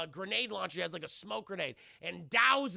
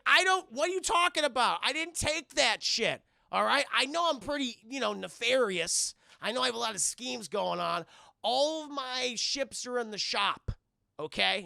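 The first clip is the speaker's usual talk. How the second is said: high, loud whiny protest; defensive Xanatos; 5 semitones higher, 7 dB louder than usual. Retold or imitated. Woken Xanatos to Goliath, loud whiny protest. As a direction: whine your innocence.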